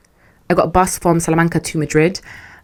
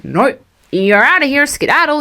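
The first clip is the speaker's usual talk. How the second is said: higher, louder shooing voice; mock dismissive boss; 9 semitones higher, 3 dB louder than usual. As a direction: shoo her off, pitched up.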